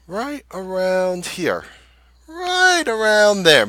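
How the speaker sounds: lingers on each word to show exactly where he bought; drawn-out pointing. Real, not acted. Trader showing viewers his entry point on the chart, slow and drawn out.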